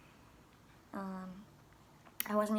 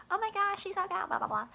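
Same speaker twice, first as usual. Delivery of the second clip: much higher, louder squeal of excitement; excited kid; 12 semitones higher, 5 dB louder than usual. Retold or imitated. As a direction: gush like a thrilled child.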